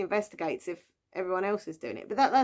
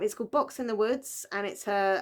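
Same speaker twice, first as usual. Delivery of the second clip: ordinary talk, nothing marked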